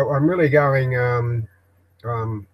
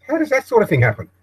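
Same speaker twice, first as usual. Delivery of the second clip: indignant question; 10 semitones higher than usual. Real, not acted. Outraged at the bank dipping in.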